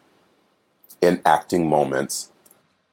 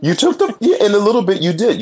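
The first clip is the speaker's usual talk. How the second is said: high excited protest; faster than usual. Real, not acted. Playful protest, laughing.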